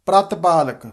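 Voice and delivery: ਪ੍ਰਤਪਾਲਕ as "prat-palak"; short a. Shown as a demonstration. Teacher modelling ਪ੍ਰਤਪਾਲਕ with short a after pr. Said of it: "ਫਿਰ ਦੇਖੋ ਕੀ ਉਚਾਰਨ ਹੈ".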